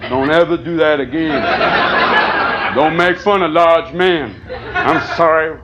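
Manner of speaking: low slow football fan voice, a lightly voiced big guy; lightly voiced hurt big football fan. A comic apologizing to a big football fan. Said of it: "a football fan going".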